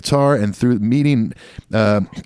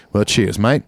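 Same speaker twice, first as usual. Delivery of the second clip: put-on Australian accent. Aussie sign-off in accent.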